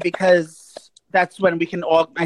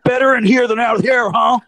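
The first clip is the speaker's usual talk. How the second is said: mock bathroom guy; 2 semitones higher than usual. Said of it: "he goes".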